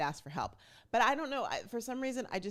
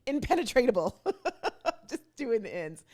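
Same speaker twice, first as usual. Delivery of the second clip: higher, louder, breaking into laughter; real laughing; 6 semitones higher, 8 dB louder than usual. Real, not acted. Speaker fumbling for a word, laughing at herself. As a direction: crack up on your own made-up word.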